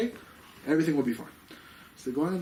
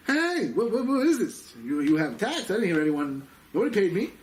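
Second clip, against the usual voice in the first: acting out an official's high, raised-voice protest; mock outrage, pitched up; indignant challenge; 7 semitones higher, 4 dB louder than usual. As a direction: halt them with an outraged demand. Acted official halting the funeral procession.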